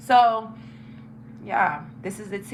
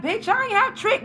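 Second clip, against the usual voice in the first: high-pitched indignant comeback; indignant retort; 11 semitones higher than usual. Retold or imitated. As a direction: deny it in a high, outraged voice. Indignant retort in retold call.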